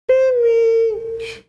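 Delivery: very high, tiny puppet-show voice; squeaky puppet Jesus. Retold or imitated. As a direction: voice a little whining Jesus like a hand puppet. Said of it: "Sound like one of those puppet shows".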